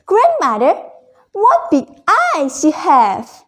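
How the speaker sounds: put-on wide-eyed girl asking grandma; wondering little girl. Girl questions the wolf.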